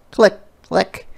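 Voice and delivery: high playful clicks; click click sound. A narrator's reading. Narrator voices the camera clicking twice, high and bright. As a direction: snap out two shutter clicks.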